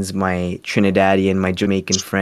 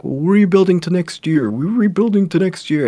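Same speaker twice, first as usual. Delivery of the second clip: high, sing-song mimicry of empty promises; mock cheerleading, pitched well up; mocking team promise; 9 semitones higher than usual. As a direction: parrot a tired slogan in a raised, put-on voice. Mocking team's repeated empty promise.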